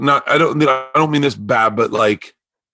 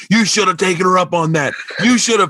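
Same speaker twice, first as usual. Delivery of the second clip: higher, pushy razzing; mocking scold; 6 semitones higher than usual. Friends razz the barker for turning her down, mocking. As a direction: rub it in that he blew it.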